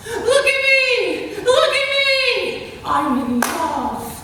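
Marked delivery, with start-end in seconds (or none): 0.1-2.7 slow, high, laughing proclamation; shouting. Acted. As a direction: call it out from the rooftops. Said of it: "Our love shouts from rooftops".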